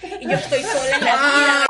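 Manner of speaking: loud, hammed-up lament; dramatic fake crying. Student faking despair to her teacher, loud mock wail. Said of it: "me metí en un performance dramático".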